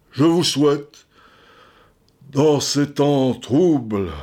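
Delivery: slow, solemn de Gaulle address with long pauses; grand presidential vows, drawn out; de Gaulle impression. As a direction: address the French like the old general. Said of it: "intonation gaulienne".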